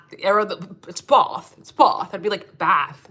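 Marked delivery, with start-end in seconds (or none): 0.9-2.1 Bath with a long open "aw" vowel, punched; "Bath" said loud as "Bawth"; British correction of Bath. Retold or imitated. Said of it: "every time I would say Bath". 2.6-3.1 Bath with a short flat "a" as in cat; short-vowel American "Bath". Retold or imitated. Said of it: "'cause I'm American".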